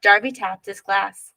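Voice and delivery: plain reading voice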